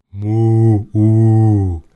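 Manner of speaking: loud, long imitated moo; cow bellow. A narrator's reading. Drawn-out cow moo. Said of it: "mylväisee sitten kumeasti".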